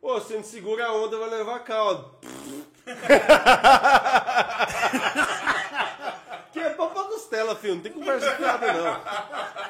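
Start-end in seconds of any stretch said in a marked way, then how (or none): 2.9-6.5 loud, high burst of laughter; long belly laugh. Real, not acted. Guest cracks up at his own joke, loud laughter. Reacting to the host's teasing. 6.5-9.7 laughing through words. Real, not acted. Guest jokes with his friends, laughing.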